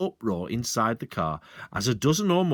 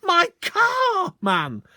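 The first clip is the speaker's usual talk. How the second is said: much higher, louder anguished cry; shouted; 6 dB louder, much slower than usual. Acted. Landowner shouting in anguish at the sergeant. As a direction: wail for the car. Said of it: "shouted Mister Hazel".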